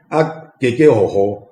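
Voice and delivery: usual speaking voice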